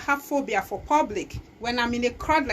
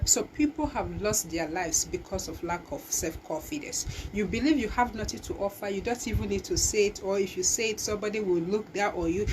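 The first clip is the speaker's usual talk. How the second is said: ordinary talk, nothing marked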